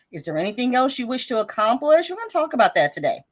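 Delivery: usual speaking voice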